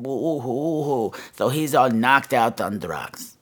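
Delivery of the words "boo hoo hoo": slow, soft mocking boo hoo; mock sobbing. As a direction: sneer at his tears. Mocking someone's crying.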